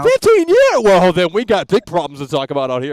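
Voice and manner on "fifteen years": high, loud burst of disbelief; incredulous yell. Real, not acted. Host throwing caller's claim back at him, incredulous yell.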